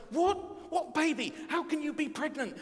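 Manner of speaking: very high, loud incredulous London soap cry; hammed-up outraged disbelief; shocked outburst. Joseph reacts to Mary's pregnancy, melodramatic shock.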